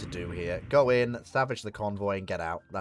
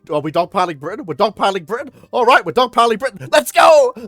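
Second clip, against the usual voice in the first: high, loud, building to an excited shout; excited questions bursting into a shouted rallying cry; eager nations piling in; 11 semitones higher, 14 dB louder, faster than usual. Acted. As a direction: get more worked up with each line, ending very high and loud. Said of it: "Spain and the U S just like".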